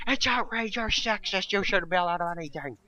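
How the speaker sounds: high, squealed mimicry of the offended; mock outrage. Mimicking critics of jokes about women, mock outraged. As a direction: imitate a hysterical complainer, pitched right up.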